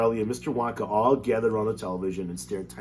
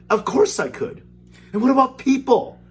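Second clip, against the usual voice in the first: put-on excited boy; 9 dB louder than usual. Excited boy questioning Wonka.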